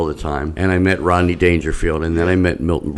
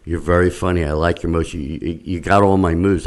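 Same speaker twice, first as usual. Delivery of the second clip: ordinary talk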